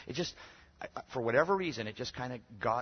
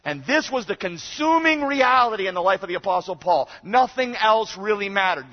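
high, loud, urgent proclamation; full-force preaching; 11 semitones higher, 13 dB louder than usual. Real, not acted. Preacher telling congregation of Paul's coming day, shouting. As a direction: thunder it out with total conviction.

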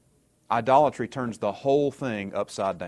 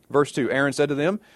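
ordinary talk, nothing marked

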